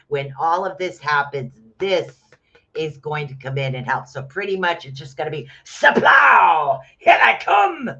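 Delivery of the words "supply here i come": high, loud battle cry; charging war god. Acted. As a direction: bellow it like Thor charging into battle. Acting Thor charging in.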